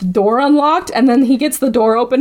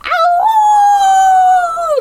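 cry of pain; 5 dB louder than usual. Retold or imitated.

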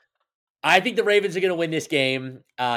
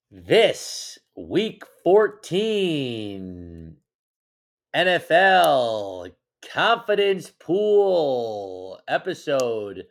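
ad read; much slower than usual. Pretend. Sponsor read intro.

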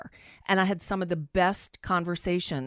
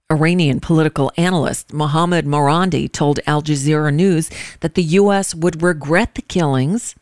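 steady, firm news anchor read; 3 semitones lower, 7 dB louder than usual. Acted. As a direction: read it like a radio news report. Reads a news report.

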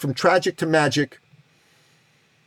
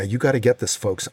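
normal talking voice

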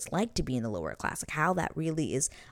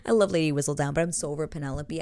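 ordinary delivery

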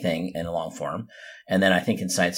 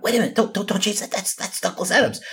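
retold excited; 13 semitones higher than usual. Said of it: "and then I went".

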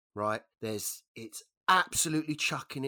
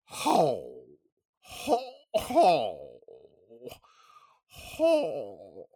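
imitated dog howls, swooping down; long falling hound howl with pauses between; 5 dB louder, much slower than usual. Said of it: "And this dog went".

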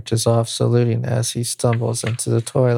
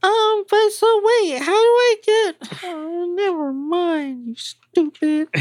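put-on much higher, flustered girl; young woman voice. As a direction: stammer like a rejected girl. Liz flustered, rebuffed.

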